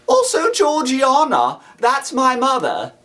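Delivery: high, timid little voice; timid child. A narrator's reading. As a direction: explain shyly, voice up high. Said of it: "I timidly explained".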